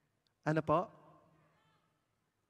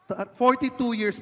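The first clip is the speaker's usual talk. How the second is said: loud repeat; 7 semitones higher, 8 dB louder than usual. Real, not acted. Repeating number to the crowd.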